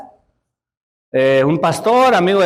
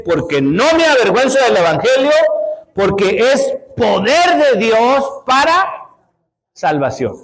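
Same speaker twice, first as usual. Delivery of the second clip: scripture recitation; 5 semitones higher than usual. Real, not acted. Reciting Romans 1:16.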